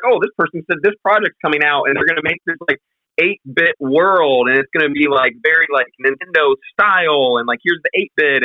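hyped shill voice. Retold or imitated. Retells hyped Discord member pitch.

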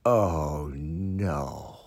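low, hushed, drawn-out dread; sinking dismay. Retold or imitated. Fan holding the ball on television, sinking dismay. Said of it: "he's like".

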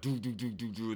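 soft repeated stuttering engine noise; imitated engine sputter. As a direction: mimic an engine turning over. Imitates engine failing to start.

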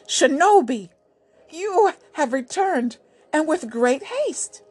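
put-on pleased old ruler. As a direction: greet the returning hero with joy.

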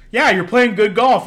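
suddenly all buddy-buddy. Retold or imitated. Golfer praising them after the spat, loud and friendly. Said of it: "He said".

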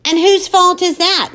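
high, loud accusing demand. Acted. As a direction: throw the blame at her. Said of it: "Mom demands".

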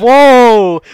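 loud, high burst of laughing alarm; startled whoa. Real, not acted. A podcaster reacting to a friend's edgy joke with a loud laughing whoa. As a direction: yelp it out, caught off guard by the joke. Shocked at a joke about China.

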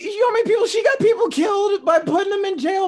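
loud, cracking outraged shout. Real, not acted. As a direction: yell it, half laughing, half crying. Heated rant about Kamala's record.

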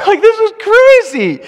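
laughing, high and loud as he says it. Acted. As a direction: laugh through the whole line. Laughs at the roof story.

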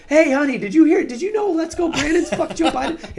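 high, loud call to a spouse; imitated excited blurting. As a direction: holler the news with no filter. Passenger blurting it out to partner.

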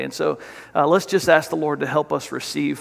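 usual speaking voice

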